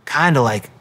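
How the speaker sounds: ordinary talk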